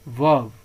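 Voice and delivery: "वर्ब" with the R silent, falling; model pronunciation. Shown as a demonstration. Teacher shows students how to read it, model pronunciation. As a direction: say "वर्ब" as "vub", no R sound. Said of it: "इसको क्या पढ़ते हैं".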